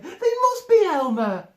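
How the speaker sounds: high, loud, laughing voice; helpless laughter. Acted. An elephant laughing helplessly at Elmer, high and loud. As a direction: laugh through the words. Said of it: "he said".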